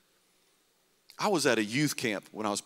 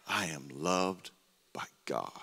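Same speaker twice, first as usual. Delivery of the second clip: hushed confession; 5 semitones lower, 7 dB quieter than usual. Acted. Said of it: "you must walk in yourself".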